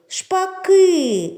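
Шпаки called out loud and high, stress on ки; calling out. Shown as a demonstration. Teacher calling the starlings with the children, loud Шпаки. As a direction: call Шпаки to the birds, leaning on ки. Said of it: "Покличемо їх".

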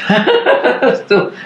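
chuckling through the word, voice jumping high; a real laugh breaking into the word. Real, not acted. Admitting he still feels young, laughing at himself. Laughs at his own phrasing.

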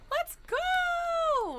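loud, very high drawn-out celebration; excited high-pitched shout; hyped cheer. Real, not acted. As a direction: whoop it out at full volume. Celebrates a new subscription.

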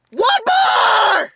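shouted, stretched in outrage; ranting. Real, not acted. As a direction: yell it at the phone. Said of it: "But I'm gonna rant".

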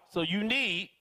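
normal talking voice

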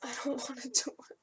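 whispered, fast dread; whispering. Real, not acted. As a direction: whisper it reluctantly.

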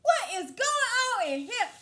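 loud, high horrified cry; retelling her shriek at the smell; shocked scream. Retold or imitated. Mom in smelly Ross store, loud shocked scream.

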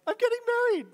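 high, loud burst of news; excited blurt. Retold or imitated. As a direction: blurt it out like a thrilled friend. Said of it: "your friend blurts out".

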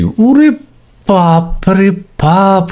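high, slow, drawn-out cry of disbelief; milk the shock for laughs; comic gasp of amazement, stretched long; mock astonishment. Amazed at viewer answers.

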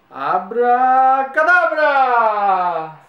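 very slow, loud grand abracadabra; booming magic word. Acted. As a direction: stretch it out and boom it like a showman.

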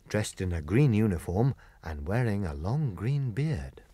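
plain reading voice

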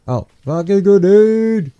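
high, loud cry of alarm; alarmed shout. Real, not acted. Player spotting a grenade, alarmed shout. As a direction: yell it as you spot danger.

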